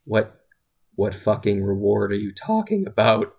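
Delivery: low-pitched, mock bewildered question; confused. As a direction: act out baffled disbelief, low. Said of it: "genuinely confused as fuck".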